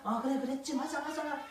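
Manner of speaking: soft imitation of neighbours murmuring together; hushed chatting. Marchers chatting to pass the time, hushed chatter. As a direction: chat under your breath to the person beside you. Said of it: "옆 사람하고 속닥속닥속닥".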